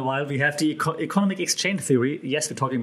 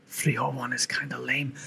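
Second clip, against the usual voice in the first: hushed sarcastic aside; whispered aside; 6 dB quieter than usual. Real, not acted. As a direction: whisper it like a secret jab at the event. Whispered aside about a lame event.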